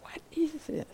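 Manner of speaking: whispered question riding the exhale; hushed, airy whisper; asked on the out-breath. Shown as a demonstration. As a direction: breathe the words out in a soft whisper. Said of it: "as you breathe out".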